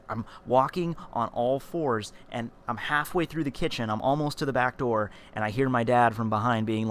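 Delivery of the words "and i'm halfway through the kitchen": ordinary delivery, nothing marked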